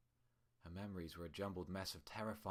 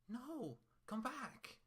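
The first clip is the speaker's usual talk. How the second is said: much higher desperate call; desperate cry; much slower than usual. Acted. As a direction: Twilight begging the voices to return. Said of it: "Twilight was desperate to hear more".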